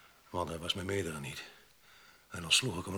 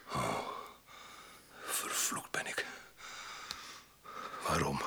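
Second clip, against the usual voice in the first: anguished whisper; 6 semitones lower, 6 dB quieter, slower than usual. Acted. Guilt breaks through.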